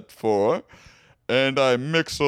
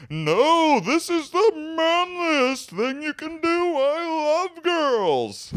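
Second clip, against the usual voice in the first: high, slow booming wizard; game show announcer; slower than usual. Acted. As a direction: boom it like a prize announcer. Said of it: "You sound like a game show announcer".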